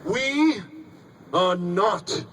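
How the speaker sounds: high, haughty, drawn-out rebuke that falls away at the end; snobby scold. Shown as a demonstration. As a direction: look down your nose and scold them. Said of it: "with your snobbiest and snottiest sounding voice".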